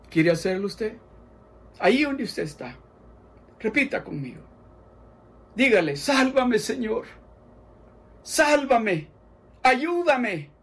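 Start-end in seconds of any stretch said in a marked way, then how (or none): 5.9-10.5 high, loud pleading prayer; fervent prayer. Acted. Preacher leading listeners in a fervent repeated prayer. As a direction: pray it out for them to repeat.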